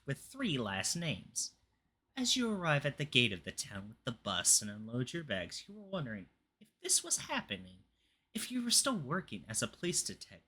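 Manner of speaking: dramatic storybook reading; story narration. Acted. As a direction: read it like a spooky tale. Reads the story note aloud.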